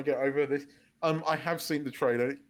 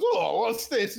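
higher, a touch of mock wonder; lightly voiced Godzilla; 5 semitones higher, slower than usual. Retelling Godzilla surfacing from the water, lightly voiced as puzzled. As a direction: lightly act out the surprised monster.

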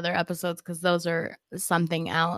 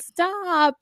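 mock coy stop; 11 semitones higher than usual. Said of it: "And you're like".

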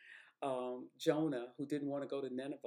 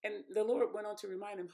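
usual speaking voice

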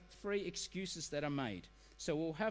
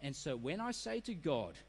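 usual speaking voice

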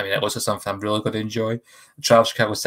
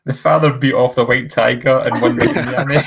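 louder, through laughter; laughing; 2 semitones higher, 5 dB louder than usual. Real, not acted. Host repeating the accidental double meaning to co-hosts, laughing. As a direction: crack up while saying it.

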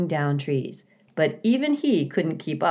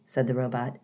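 plain reading voice

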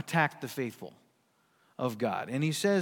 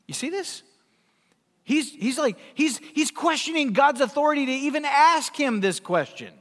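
usual speaking voice

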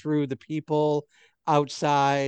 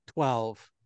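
usual speaking voice

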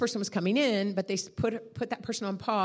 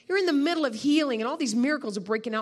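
ordinary talk, nothing marked

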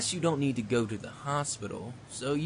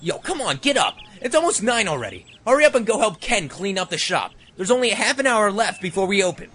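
loud, much higher hollering; wake-up yell; 6 semitones higher, 8 dB louder than usual. Acted. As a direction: yell him out of bed, impatient. Roommate yelling him awake.

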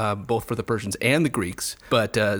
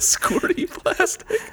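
breathless giggling; much slower than usual. Real, not acted. Mocking the ad word.

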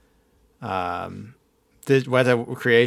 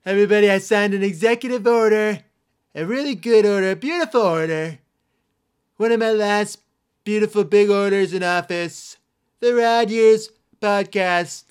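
mock presidential boasting, pitched way up; bad Trump impression; 10 semitones higher than usual. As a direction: do a deliberately bad blustery politician. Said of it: "Bad Trump".

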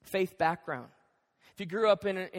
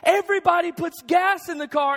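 raised, higher-pitched protest; hammed-up exasperated retort; mock exasperation; 8 semitones higher, 6 dB louder than usual. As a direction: act out a husband arguing back, baffled. Acted quarrel, husband answering back.

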